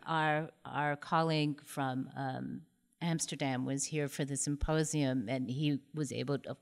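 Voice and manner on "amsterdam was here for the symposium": usual speaking voice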